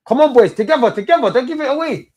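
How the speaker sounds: high, loud rallying call to the team; shouting encouragement. Real, not acted. Urging players during the match.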